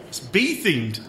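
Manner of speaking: normal talking voice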